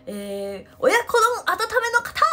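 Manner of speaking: high, loud call across a busy shop; clerk shouting orders. Retold or imitated. Imitating the clerk calling out a warmed order, loud shout. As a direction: bellow it like a convenience store clerk. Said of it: "店員さんもめっちゃ大きい声で".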